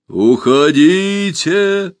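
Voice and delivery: slow, drawn-out booming creature call; muffled monster voice. A narrator's reading. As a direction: loom over the forest with a hollow voice. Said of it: "глухой голос".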